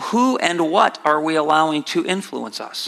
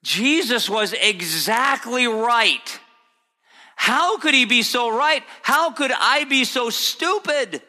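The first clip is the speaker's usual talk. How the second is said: high-pitched, incredulous self-reproach; preacher voicing a disciple berating himself, pitch raised throughout; Peter's anguished realization; 9 semitones higher than usual. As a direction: cry out the realization in a high, impassioned voice. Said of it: "in Peter's mind".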